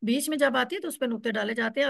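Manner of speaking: ordinary talk, nothing marked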